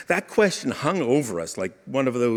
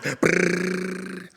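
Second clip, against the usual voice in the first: higher buzzing brrr imitating impact; ornament thud noise; 7 semitones higher than usual. Father imagines a falling ornament, mock brrr impact noise. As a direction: buzz out a brrr like metal sticking in wood. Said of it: "Would it make the same sound?".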